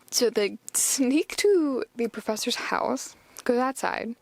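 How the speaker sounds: usual speaking voice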